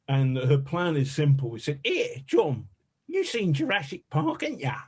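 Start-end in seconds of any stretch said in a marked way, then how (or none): 1.8-4.8 a high, cheeky Essex voice doing Sarah; Essex accent. Retold or imitated. Producer Sarah, from Essex, pitching her plan to John, in an Essex accent. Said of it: "She's from Essex".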